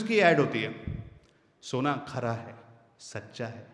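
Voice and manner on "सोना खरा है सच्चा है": much lower and softer; soft trustworthy ad. Shown as a demonstration. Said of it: "उसने volume down किया".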